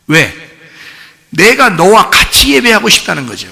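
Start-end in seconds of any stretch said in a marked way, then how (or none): none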